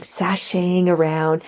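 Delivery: ordinary talk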